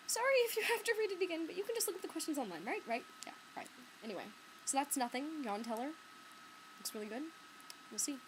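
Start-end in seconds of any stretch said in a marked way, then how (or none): 0.1-3.7 high opening, then tumbling out faster; retold mock apology. Patron excusing herself to librarian, high apology speeding up. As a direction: start pitched up, then hurry through the excuse. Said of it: "So I'm like".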